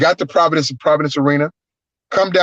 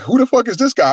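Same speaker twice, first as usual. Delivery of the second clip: mock disbelief; 8 semitones higher than usual. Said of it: "people was like".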